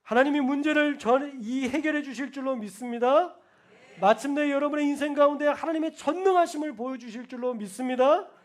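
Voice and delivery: loud rising proclamation; preaching at full force. Acted. Pastor blessing the congregation, loud. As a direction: proclaim it over the crowd.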